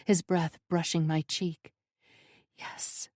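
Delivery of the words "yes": breathless whispered yes. A narrator's reading. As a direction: barely breathe it. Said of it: "I whisper".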